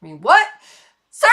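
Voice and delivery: loud, incredulous outburst; hammed-up indignant disbelief; mock outrage. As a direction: call out the commenter in playful offence. Keeps objecting to the comment.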